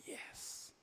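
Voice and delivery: breath-only little victory yes; whispered cheer. Retold or imitated. Son to his brother as mother cries over TV, whispered cheer. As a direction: whisper a celebration to your accomplice.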